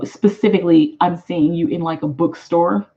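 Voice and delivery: ordinary talk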